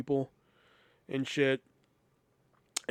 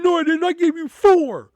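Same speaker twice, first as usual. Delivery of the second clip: angry customer; 14 semitones higher, 11 dB louder than usual. Retold or imitated. Customer insisting to the cashier, high loud yelling. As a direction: shout him down. Customer arguing back angrily.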